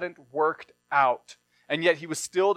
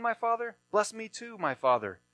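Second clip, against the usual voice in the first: higher, mildly tearful begging; weeping plea; 5 semitones higher, 5 dB quieter than usual. Acted. Esau begs his father a second time, understated weeping. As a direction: plead again with a hint of crying. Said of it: "And Esau wept loudly".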